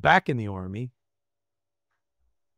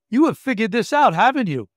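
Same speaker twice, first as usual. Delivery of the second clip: higher, louder knowing accusation; imitating wife's knowing challenge; 7 semitones higher, 7 dB louder than usual. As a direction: voice the wife seeing through him.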